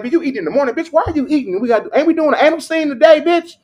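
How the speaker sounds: high, loud disbelieving scold; incredulous scolding. Retold or imitated. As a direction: yell at her in disbelief. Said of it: "Bitch, I come on set like".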